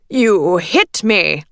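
slow, outraged man's voice; a fat man crying out, slow and outraged. A narrator's reading. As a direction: cry out, stung and indignant. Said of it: "The fat man's cry was all too loud, nasal, and outraged".

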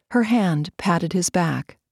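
ordinary delivery

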